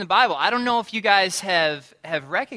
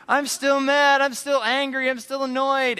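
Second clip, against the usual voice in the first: high, louder hammed-up complaint; mock angry rant; 9 semitones higher, 5 dB louder than usual. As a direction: pile up the gripes in a mock huff. Voicing anger after praying.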